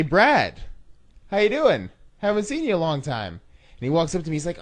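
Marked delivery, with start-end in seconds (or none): 0.0-3.4 loud, high-pitched hello to someone back; delighted greeting. Retold or imitated. Campaign rep greets Brad after a long absence, excited. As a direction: call out a big cheery welcome.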